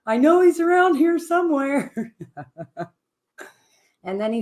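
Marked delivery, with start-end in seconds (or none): 0.1-2.1 high, bright sing-song call with a laugh; mock sing-song search. Guest retelling how she greets chat rooms, high and playful. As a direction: call out like searching for a lost friend. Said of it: "I'm like".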